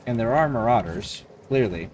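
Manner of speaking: ordinary talk, nothing marked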